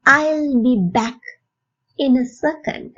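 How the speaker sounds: slow, evenly spaced teaching delivery; slow model phrase. Shown as a demonstration. Teacher to English learners, slow model phrase. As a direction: say it slowly for learners to copy.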